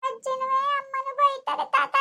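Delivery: high, drawn-out tearful complaint. Acted. Child tells grandfather who scolded them, crying. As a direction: whine it slowly to grandpa, tearfully. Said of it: "ಯಾಕ್ ಅಳ್ತಾ ಇದ್ದೀ".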